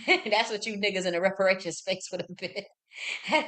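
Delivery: words broken by loud laughter; laughing while talking. Real, not acted. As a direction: keep laughing through the punchline. Laughing through the punchline.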